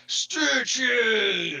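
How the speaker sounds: high, loud roaring chant; crowd shout. Acted. The pit crowd answering Krebs, loud high chant. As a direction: bellow it like a whole arena. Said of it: "the crowd all, uh, shout".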